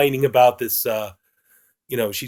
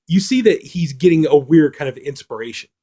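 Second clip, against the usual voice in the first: ordinary talk, nothing marked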